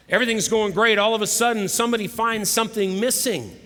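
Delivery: lively storyteller pushing the suspense; excited build-up. A narrator's reading. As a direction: announce the sudden turn with rising energy.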